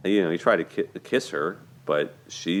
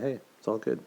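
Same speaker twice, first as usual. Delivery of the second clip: higher, softer, smiling easy tone; smiling reassurance; 5 semitones higher, 6 dB quieter, faster than usual. Retold or imitated. Boyfriend shrugging off her secret, soft smiling reassurance. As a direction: say it with a smile, shrugging it off. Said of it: "He just smiles and says".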